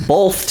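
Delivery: "Both" pronounced "bolth", with an L before the th; BOTH with an added L. Shown as a demonstration. Host defending his pronunciation to co-host, "Both" said as "bolth". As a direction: put an L into "Both". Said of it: "Both has an L in it".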